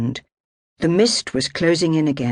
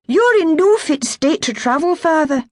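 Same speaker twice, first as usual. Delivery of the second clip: put-on much higher, firmer young woman's voice; anxious daughter; 14 semitones higher, 5 dB louder than usual. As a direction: hold him back with worried care. Kirsty's higher voice.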